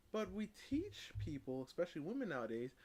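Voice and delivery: usual speaking voice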